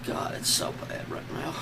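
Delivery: ordinary talk, nothing marked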